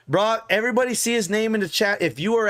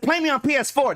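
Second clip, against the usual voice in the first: mock Goggins challenge, pitched up; 8 semitones higher than usual. Streamer mimics Goggins challenging him, pitched-up mock voice. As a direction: imitate Goggins calling him out, higher. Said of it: "That's my best Goggins impression".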